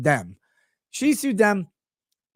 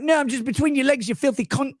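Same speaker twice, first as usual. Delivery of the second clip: high-pitched mock British man; British accent; 7 semitones higher than usual. British client insists to the prostitute, mock British accent. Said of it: "he talks the same because they're British".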